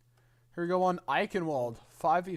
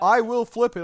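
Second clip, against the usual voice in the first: playful shout; 6 semitones higher, 11 dB louder than usual. Pretend. Playful standoff over coin flip.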